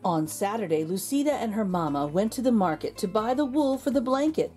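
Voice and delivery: ordinary delivery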